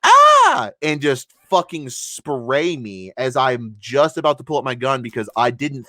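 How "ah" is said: sudden high scream of surprise; startled yelp. Retold or imitated. Teammate startled on the stairs, high yelp. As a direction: yelp in shock as he turns on you. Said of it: "And you go".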